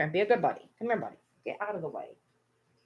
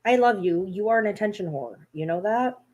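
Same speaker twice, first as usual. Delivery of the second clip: ordinary delivery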